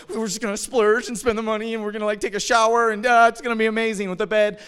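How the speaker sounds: high, loud, gushing plans; retold burst of excitement; excited. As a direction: rush through it with giddy anticipation. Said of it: "We got all excited".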